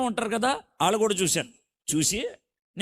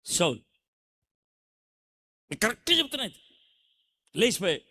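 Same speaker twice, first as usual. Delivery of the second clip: ordinary delivery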